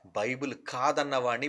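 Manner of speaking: a quiet lead-in, saving the force for the next line; dropped low. Acted. Preacher setting up his challenge to critics, quiet and low.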